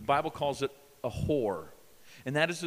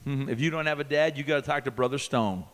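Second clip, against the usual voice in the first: usual speaking voice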